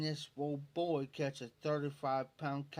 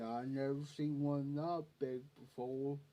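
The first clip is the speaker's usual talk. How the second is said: slow, drawn-out awestruck drawl; slow-talking Leroy impression; 4 dB quieter than usual. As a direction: imitate a slow talker, stretching the vowels. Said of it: "That's how he talked".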